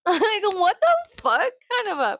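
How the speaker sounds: high, disbelieving outburst with a laugh; incredulous. Real, not acted. Vlogger reacting to death-threat comments, high-pitched disbelief. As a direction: squeal it out in disbelief.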